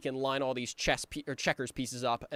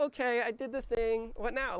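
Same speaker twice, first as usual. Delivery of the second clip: higher, innocent little voice; wholesome Billy; 11 semitones higher than usual. Retold or imitated. Billy hoping Riggy is proud, wholesome higher voice. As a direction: sound sweetly pleased. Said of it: "he's being very wholesome".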